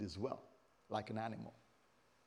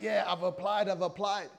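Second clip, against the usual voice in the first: louder, higher, eager voice; eager applicant; 6 semitones higher, 6 dB louder than usual. Acted. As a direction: act out keen self-assurance. Acts out eager job applicant.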